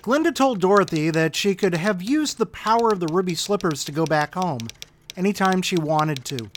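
ordinary delivery, nothing marked

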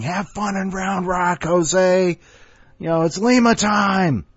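high jeering ballpark yell; crowd yelling. Retold or imitated. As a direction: jeer at the pitcher from the stands. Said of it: "And we're all yelling".

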